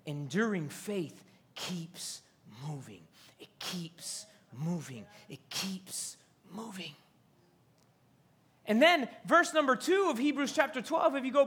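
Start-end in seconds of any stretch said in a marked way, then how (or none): 0.1-3.0 hushed emphasis. Real, not acted. Preacher hushing the congregation on enduring faith, hushed. 3.4-6.9 unvoiced whispered repetition. Real, not acted. Preacher repeating the point to the congregation, whispered.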